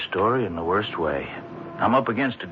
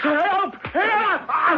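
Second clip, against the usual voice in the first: panicked, high screaming for help; cries for help. Acted. Reporter attacked by the judge on a dark street, screaming for help. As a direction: yell for your life as the knife comes out.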